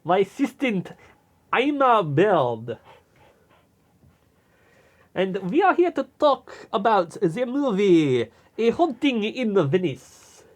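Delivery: put-on lightly done Belgian detective accent; a hint of a Belgian sleuth accent. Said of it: "We were just doing a bit".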